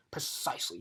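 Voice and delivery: ordinary delivery, nothing marked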